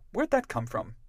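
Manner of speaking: surprised. Acted. Narrator startled by what the match reveals, surprised. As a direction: blurt it out in surprise.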